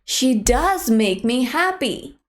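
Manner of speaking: "does" stressed with a fall. Shown as a demonstration. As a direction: insist on "does". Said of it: "make somebody believe you".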